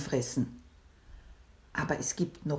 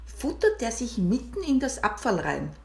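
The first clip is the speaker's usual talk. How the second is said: plain reading voice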